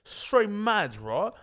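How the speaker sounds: high British lad voice; mock British accent. Lad insists he is mad, mock British accent. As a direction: play up the British slang accent. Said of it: "it's an easy impression".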